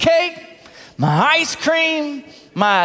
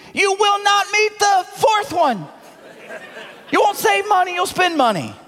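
fiery preaching; 7 semitones higher than usual. Acted. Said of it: "preaching climax".

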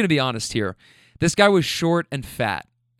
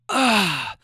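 higher, drawn-out effort groan; straining grunt; 5 semitones higher than usual. Retold or imitated. Heavy man reaching for car door, imitated straining grunt.

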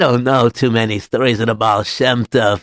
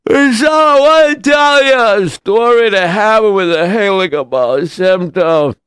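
drawn-out, high yawning voice; hammed-up tiredness; 8 semitones higher, 4 dB louder, slower than usual. Weary storyteller starts his tale for a crowd, hammed-up tiredness. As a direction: yawn through the words, sinking in pitch. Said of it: "he was feeling tired".

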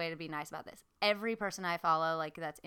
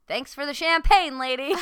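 imitated triumphant holler; retold taunting call; 7 semitones higher, 12 dB louder than usual. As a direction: call it up to someone a floor away. Husband calls up to shop assistant.

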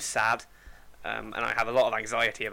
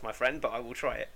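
usual speaking voice

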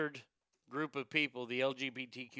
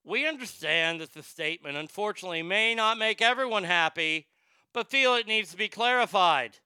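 louder, higher corporate statement voice; reading the statement; 5 semitones higher, 7 dB louder than usual. Retold or imitated. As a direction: read it out like a stiff press release. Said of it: "They go on to say on their website".

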